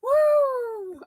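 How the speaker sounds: long, very high woo; amused whoop. Real, not acted. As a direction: whoop as if overwhelmed. Reeling from the messy clip.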